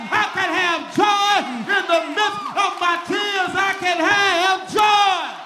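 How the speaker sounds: high, loud, sung-out preacher's climax; rising, chanting sermon cry of joy; preaching at full force. Acted.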